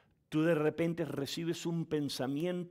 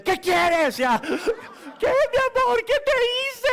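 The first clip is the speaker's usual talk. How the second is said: much higher, loud, laughing wife's whine; laughing mock wife; 12 dB louder, slower than usual. Wife asking her husband what's wrong, laughing squeals.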